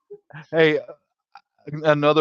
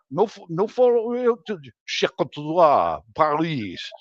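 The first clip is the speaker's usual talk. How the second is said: hammed-up fake French accent, higher; mock French; 5 semitones higher than usual. Faking French for days, hammed-up French accent. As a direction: babble French-ish words. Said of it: "I could sound almost like I am a Frenchman".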